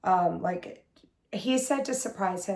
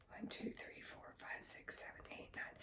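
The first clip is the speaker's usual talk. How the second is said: very soft, unvoiced-sounding whisper; barely audible private tally; whispered counting; 21 dB quieter than usual. Real, not acted. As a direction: count under your breath to yourself. Counting colors to herself.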